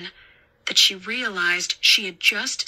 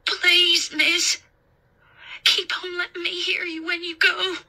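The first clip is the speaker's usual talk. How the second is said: put-on high, tearful child voice; plaintive little-boy plea; pleading boy; 10 semitones higher than usual. A narrator's reading. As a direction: beg a grown-up not to vanish. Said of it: "he pleaded".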